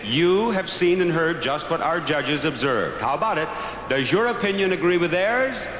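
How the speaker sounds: bright pageant host announcement; announcer. Acted. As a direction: announce it to the audience.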